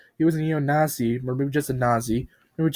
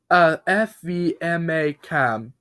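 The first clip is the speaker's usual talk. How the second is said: spelled out; 5 semitones higher, slower than usual. Acted. Bob spells out the camp.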